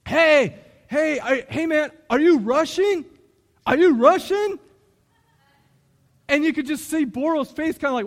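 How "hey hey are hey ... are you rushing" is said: loud, higher, eager recruiting pitch; pushy frat guy. Retold or imitated. Frat guy badgering Boro to rush, loud and eager. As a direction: keep pressing him, louder each time. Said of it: "and he's like".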